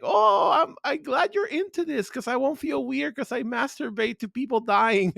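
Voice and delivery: mock happy. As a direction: chirp it like a delighted girl. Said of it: "she got all happy".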